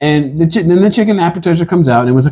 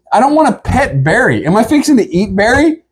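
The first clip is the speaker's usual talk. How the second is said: alarmed disbelief; 8 semitones higher than usual. Retold or imitated. Retold alarm at the goat.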